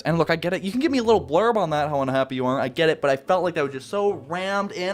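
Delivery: usual speaking voice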